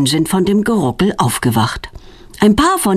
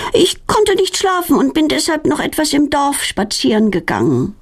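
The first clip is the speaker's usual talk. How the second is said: higher, sweet innocent fib; feigned innocence; 7 semitones higher than usual. A narrator's reading. Girl lying to her worried parents, acting innocent. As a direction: play the innocent little liar. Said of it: "Claudia schwindelt und tut ganz unschuldig".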